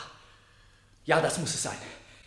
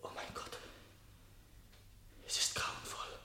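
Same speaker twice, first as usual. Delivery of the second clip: whispered to himself, almost inaudible; barely voiced whisper of horror; horrified whisper; 19 dB quieter than usual. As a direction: breathe it out in shock. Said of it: "Sprechen Sie lauter".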